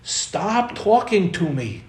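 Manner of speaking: put-on high, loud, slow child's yell at the ceiling; little daughter yelling at the store loudspeaker, high and slow. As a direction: shout it up at the ceiling like an upset little girl. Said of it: "she goes".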